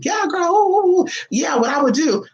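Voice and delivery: high-pitched imitation of gossipy chat; mock girly chatter. Two cousins chatting in the bathroom, mock chatter.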